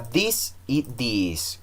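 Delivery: this short, these with a long drawn ee; long vowel in these. Shown as a demonstration. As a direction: stretch the ee of these against a short this. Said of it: "que es un poco más largo".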